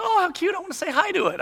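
high, cooing little voice; imitated daughter. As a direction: mimic a daughter gushing over a cute animal. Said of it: "and my daughter goes".

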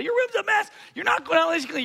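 high, scolding parent voice; mock stern parent. Parent scolding daughter about her messy room, mock stern.

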